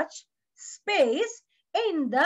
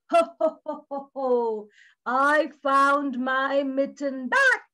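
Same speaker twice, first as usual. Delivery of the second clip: Santa Claus; 2 semitones lower than usual. Acted. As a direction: chuckle heartily and draw the words out. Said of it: "reached back to Santa".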